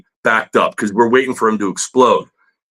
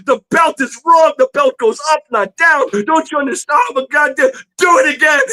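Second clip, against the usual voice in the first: much higher, rising to a shout at the end; teacher's angry rant; 14 semitones higher than usual. Retold or imitated. Teacher tracing over the drawing before everyone, rising angry rant. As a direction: berate the student, then bark the order. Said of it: "And he walks out of the room".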